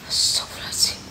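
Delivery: hushed prayer, barely voiced; scared whisper. Real, not acted. Calling for Erda in the dark, then whispering a prayer. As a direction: murmur it under your breath in fear.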